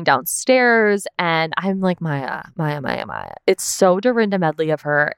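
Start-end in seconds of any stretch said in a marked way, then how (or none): 2.0-3.3 low, soft mutter of a name; mock chiding. Viewer reacting to Maya fueling the fire, mock chiding. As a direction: chide her under your breath, lightly.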